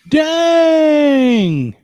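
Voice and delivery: loud delighted outburst; excited shout. Real, not acted. Excited reaction to Trump stunner joke.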